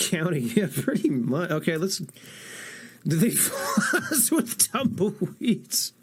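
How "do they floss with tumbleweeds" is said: laughing. Real, not acted. Joking about rednecks.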